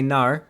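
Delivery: "nar" with an ar vowel in place of no; "nar", as New Zealanders say no; New Zealand pronunciation. Retold or imitated. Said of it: "They say".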